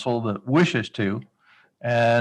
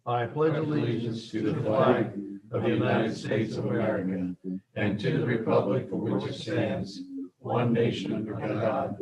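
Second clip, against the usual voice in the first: pledge recitation; 5 dB quieter than usual. Real, not acted.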